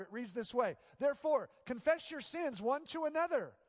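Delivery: preaching. Acted. Preaching at full force.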